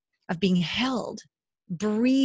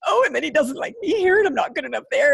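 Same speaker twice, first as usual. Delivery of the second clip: whiny self-pity; 6 semitones higher, 3 dB louder, faster than usual. Retold or imitated. Retelling her hurt reaction.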